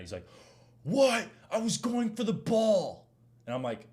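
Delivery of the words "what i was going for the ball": loud, high, slow mock protest; exaggerated outraged imitation of the fouler; protesting buddy impression. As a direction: whine it loudly like an indignant opponent. Acts out buddy protesting the foul.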